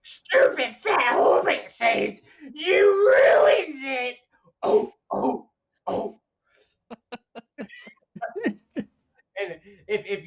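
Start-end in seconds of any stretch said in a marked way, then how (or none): 0.0-4.2 high, loud, drawn-out creature screech; Gollum. Acted. Creature scolding the hobbit over his cooking, Gollum voice. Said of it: "everybody knows Gollum from Lord of the Rings". 4.6-6.2 high creature throat noises; short gulping coughs in creature voice; Gollum. Acted. Podcaster finishing his creature impression, Gollum noises. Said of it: "everybody knows Gollum from Lord of the Rings".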